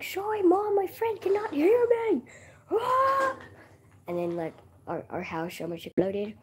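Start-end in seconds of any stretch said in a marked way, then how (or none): 0.0-2.2 high, loud mock apology to Mom; apologizing to Mom. Kid apologizing to Mom for the noise, high and loud. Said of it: "I'm like". 2.7-3.4 mock scream. Scream after retold scolding.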